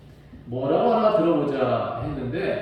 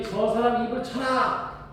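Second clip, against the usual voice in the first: high, slow commanding order; high priest's order; 7 semitones higher, slower than usual. Retold or imitated. High priest ordering Paul struck, commanding. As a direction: order him struck, drawn out. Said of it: "옆에 있는 사람한테 말을 해요".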